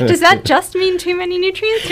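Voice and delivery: words through a laugh. Real, not acted. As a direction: ask it still laughing. Asking while laughing.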